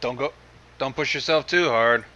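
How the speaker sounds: ordinary talk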